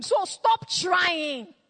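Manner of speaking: slow, drawn-out shout; preaching shout. Acted. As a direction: cry it out slowly to the congregation. Preacher cries out to the crowd.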